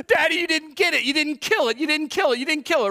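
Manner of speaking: high, loud, frantic accusation; screaming child. Retold or imitated. Daughter telling her dad the bee still flies, screamed. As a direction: wail it like a panicked little girl. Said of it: "his daughter kept screaming".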